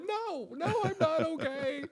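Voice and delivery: mock sobbing wail. Crying dad answers his kids, high hammed-up wail. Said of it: "I'm like".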